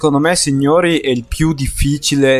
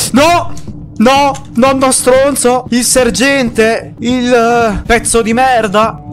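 furious yelling; 6 semitones higher, slower than usual. Real, not acted. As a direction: yell curses at the enemy. Grandpa attacks, cursing.

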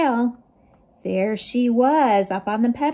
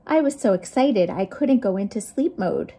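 ordinary delivery

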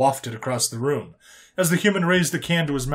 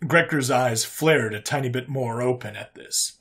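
plain reading voice

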